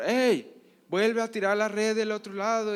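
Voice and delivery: high, loud call across the water; the Lord shouting from the shore to Pedro; shouting again. Acted. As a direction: holler it to the boat. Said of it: "le vuelve a gritar el Señor".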